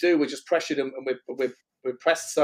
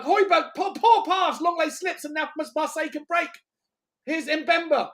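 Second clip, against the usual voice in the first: excited commentary; 10 semitones higher, 3 dB louder than usual. Real, not acted. Urging players live.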